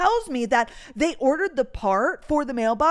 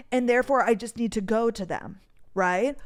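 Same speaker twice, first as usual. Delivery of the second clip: ordinary delivery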